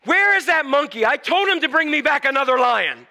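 high, indignant mock shout; hammed-up punchline yell; loud bluff. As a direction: demand it loudly so everyone overhears. Dog bluffs loudly for lion to overhear.

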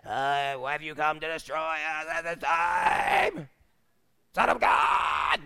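slow, drawn-out hammed-up demon voice; movie demoniac. Mocking the exorcist-film idea of possession, slow demon voice. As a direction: ham it up as a Hollywood possessed man. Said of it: "we gotta get movies out of our".

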